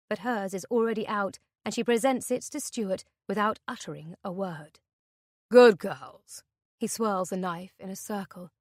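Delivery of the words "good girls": mocking captor. Captor praises their obedience.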